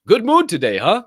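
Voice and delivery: high, loud cheerful question; friendly locker room guy. Retold or imitated. Locker room guy teasing, loud and cheerful. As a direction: tease him about his good mood.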